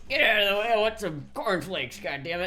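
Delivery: loud, high pretend yell at shoppers; hammed-up cart driver shouting people aside; mock road-rage yell. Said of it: "just, like".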